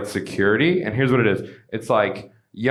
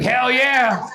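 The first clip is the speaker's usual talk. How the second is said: hyped stranger; 9 semitones higher, slower than usual. Retold or imitated. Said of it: "He's like".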